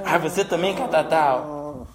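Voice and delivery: normal talking voice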